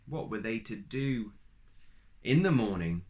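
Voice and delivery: ordinary delivery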